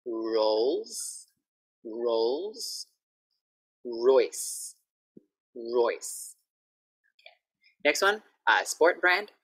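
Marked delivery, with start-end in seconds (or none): 0.1-6.4 "Rolls Royce" with a strong curled R; R drill. Shown as a demonstration. Teacher drilling R for Thai learners. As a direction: drill the R carefully. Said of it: "An R and S again".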